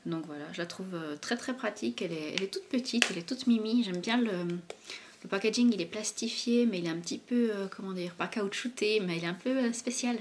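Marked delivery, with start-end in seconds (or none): none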